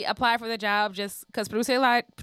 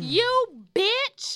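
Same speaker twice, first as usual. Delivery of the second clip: squealed much higher, like a hyped fan; mock diva shout; 13 semitones higher than usual. Imitating the crowd at Essence Fest, high squeal. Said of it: "like, punctuated".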